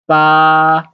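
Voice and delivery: Ba held long and level; level Mandarin tone on Ba, no fall; first tone. Shown as a demonstration. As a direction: hold Ba loud on one steady note. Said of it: "the first tone".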